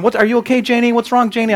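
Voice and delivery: high-pitched fretting mom; concerned mom. Retold or imitated. Mom to crying two-year-old in the back, concerned. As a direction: imitate a mother anxiously checking on her toddler. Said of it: "so mom's going".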